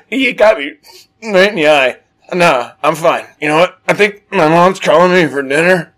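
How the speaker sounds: high, loud mock-tearful excuse; holding back tears. Boy excusing himself before the girl, mock holding back tears. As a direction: imitate a hurt boy fighting not to cry. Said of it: "I didn't wanna cry".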